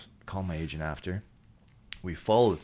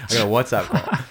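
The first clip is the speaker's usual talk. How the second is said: much louder to wake them up; loud restart; 5 semitones higher, 11 dB louder than usual. Real, not acted. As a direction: boom it out over the yawning. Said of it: "Oh, yeah, you're yawning".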